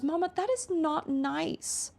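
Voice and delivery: a light imitation of a pouty little girl; toddler voice. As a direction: play the hurt toddler scolding mama. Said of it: "And she goes".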